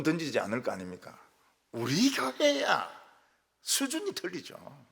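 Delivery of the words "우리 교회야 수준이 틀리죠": much higher, drawn out slowly with a smug lift; mock proud boast. Pastor boasting about his own church, slow and hammed-up proud. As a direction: crow it like a proud braggart.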